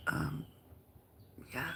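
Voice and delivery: ordinary delivery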